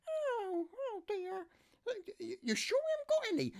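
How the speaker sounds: high falsetto mock fretting niece. Niece dismayed there's no puree, falsetto fret. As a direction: play the niece worried, way up high. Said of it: "this is my niece Laura".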